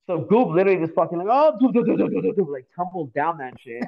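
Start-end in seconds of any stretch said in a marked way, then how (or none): 1.3-2.5 quick run of higher tumbling sounds; imitated tumbling noise. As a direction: mimic someone tumbling down in rapid syllables.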